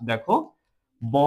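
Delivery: normal talking voice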